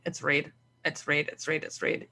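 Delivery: hushed, almost whispered repetition of a silent thought; inner voice. Retold or imitated. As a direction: keep repeating it under your breath. Silently urging the client on.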